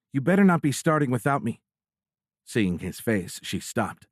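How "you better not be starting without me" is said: higher-pitched mock scolding; playfully admonish, pitched up. A narrator's reading. Girlfriend at the door warns her boyfriend, admonishing. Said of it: "pointed an admonishing finger".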